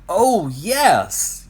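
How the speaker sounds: high, loud happy cheer. Acted. Friends cheer the new name triangle. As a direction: cheer it out together. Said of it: "Euclid's friends cheered".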